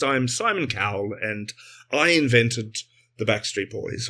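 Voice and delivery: measured classic English accent; Simon Cowell. Shown as a demonstration. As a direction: put on a restrained, classic English voice. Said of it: "He's very classic English".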